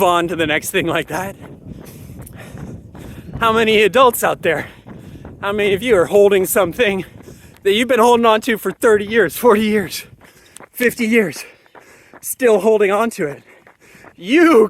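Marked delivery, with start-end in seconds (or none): none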